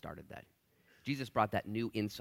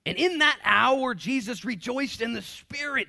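slow, much higher, louder proclamation; proclaimed verse; 14 semitones higher, 12 dB louder, slower than usual. Acted. Preacher reading Jesus rejoicing to church, loud and slow. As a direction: proclaim it slowly to the rafters.

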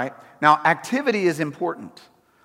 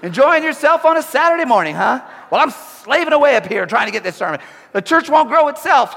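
much higher, louder bitter mock grumble; a martyr sneering down from the office; resentful sarcasm; 8 semitones higher, 6 dB louder than usual. Pastor in his office resenting happy Saturday crowds, hammed-up sarcasm. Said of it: "I'll look down and be like".

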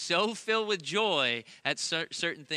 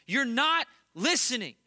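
put-on high, loud hurt child voice; a small son wailing that daddy won't listen; imitating a hurt young boy, pitched up and loud; upset little boy; 10 semitones higher, 7 dB louder than usual. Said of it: "he always says the same thing".

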